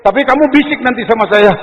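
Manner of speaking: ordinary delivery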